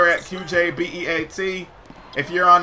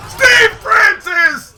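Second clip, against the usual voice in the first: drawn-out yell of the name, much higher and louder; hype shout; 12 semitones higher, 7 dB louder, much slower than usual. Real, not acted. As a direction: belt the name out slowly like an arena announcer. Hyped shout of player name.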